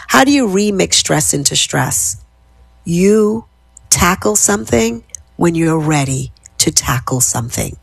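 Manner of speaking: ordinary delivery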